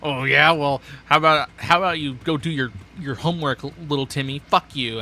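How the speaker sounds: loud mock argument with an imaginary kid; half-joking angry rant; mad at himself. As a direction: snap at an invisible heckler. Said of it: "I start getting mad".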